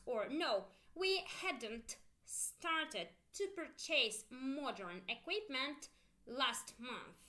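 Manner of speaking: slow "we'd started" then "we hadn't started"; slow model answer. Shown as a demonstration. Teacher modelling yes and no answers slowly. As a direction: draw out both answers for repetition. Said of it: "listen carefully".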